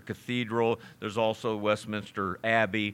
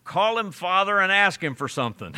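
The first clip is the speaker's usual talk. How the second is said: loud, high-starting blunt call; audible reply; 6 semitones higher, 5 dB louder than usual. Retold or imitated. Old man interrupting the stranger's long prayer, loud and blunt. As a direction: call it out sharply to cut in on a prayer. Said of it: "an old man who knew how to pray audibly replied".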